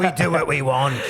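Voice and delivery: mock northern English accent; put-on northern British mouthful. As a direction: repeat it as a caricatured British northerner. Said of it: "you were up in the north".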